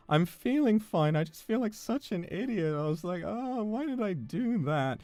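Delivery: self-pitying whine. Retold or imitated. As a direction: whimper it out, ashamed of yourself. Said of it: "And I said".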